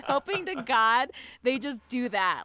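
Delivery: ordinary talk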